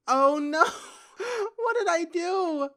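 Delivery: high, hammed-up wailing cry; theatrical tearful outcry, pitched high; mock despair. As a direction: wail in overdone regret, starting loud. Dramatic regret over game events.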